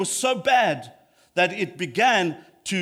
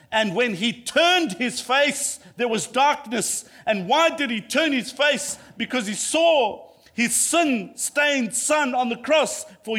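raised, higher-pitched preacher's voice; fervent preaching; 6 semitones higher, 3 dB louder than usual. Real, not acted. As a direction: proclaim it to a congregation with fervour. Preaches with rising fervour.

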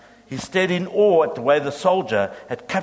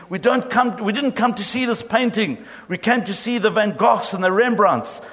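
high, raised and indignant; angry shout; 6 semitones higher than usual. A narrator's reading. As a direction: demand it, fed up with the wait. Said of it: "Another voice shouted angrily".

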